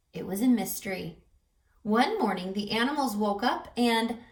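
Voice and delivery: ordinary delivery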